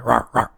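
short mock dog barks; imitated dog bark. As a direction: bark like a dog, two quick rawrs. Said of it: "Barking is like".

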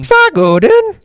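squeaky high "So good 'un"; high squeaky catchphrase. Pretend.

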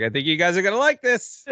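high, climbing showman's tease; imitated league commissioner. Commissioner walking out to surprise the draft crowd, high and teasing. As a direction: build it upward to a gleeful reveal. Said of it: "and he's like".

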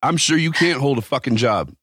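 high-pitched scolding retort; retold indignant telling-off. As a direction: re-enact an indignant dressing-down. Acts out telling off worker.

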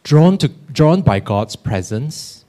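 slow, solemn call to worship; liturgical reading. Acted. Opens responsive call to worship.

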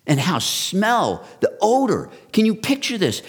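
high, loud, pressing sermon climax; preaching at full force. Acted. Pastor driving home the ark's misery, preaching hard. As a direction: hammer the misery of the ark home to the crowd.

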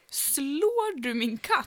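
very slow, drawn-out accusing question; mock accusation. Accusing her co-host of hitting her cat, very slowly. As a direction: stretch every word like a stern interrogator.

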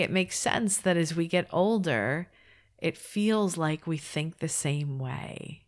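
normal talking voice

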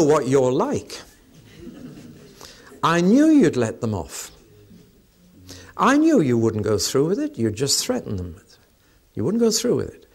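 mild indignant reproach, retold; angry complaint. Jonah grumbles to God for sparing the city, mildly angry. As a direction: act out a touch of accusing anger. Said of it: "He was quite angry".

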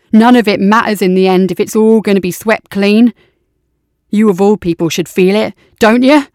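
impassioned soldier. Acted. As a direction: build into fervent conviction. Tybalt preaches nihilism.

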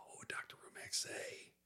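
unvoiced whisper, thinking aloud; whispered to himself. Real, not acted. Stumped host whispers to himself while thinking. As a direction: whisper the question to yourself.

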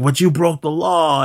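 high-pitched mock protest; retold protest. Pinkerton's protest acted out.

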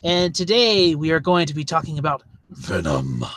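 mock-brooding edgy voice; edgelord voice. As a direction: announce it like a moody edgelord. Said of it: "That, that's my edgelord voice".

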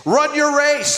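usual speaking voice

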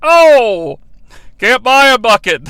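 loud arcade-announcer call on "can't buy a bucket"; punchy game announcer; NBA Jam announcer. Retold or imitated. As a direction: hype it like a video game commentator. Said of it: "just like the N B A Jam announcer".

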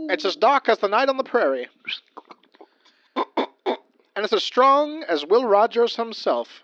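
booming, high cowboy ad read; ad announcer voice. Pretend. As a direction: sell it like a corny old radio ad. Mock coffee commercial read.

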